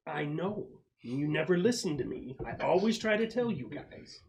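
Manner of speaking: an aggrieved scout pleading with the squad; whiny complaint. Acted. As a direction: sulk that nobody listens to you. Bad Vibes complains to squad.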